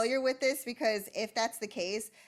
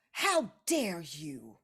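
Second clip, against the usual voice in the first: indignant defense; 3 semitones higher than usual. Acted. Dia snaps at Bruce for scolding the queen, mildly indignant. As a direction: defend the queen with mild outrage. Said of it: "slams the table".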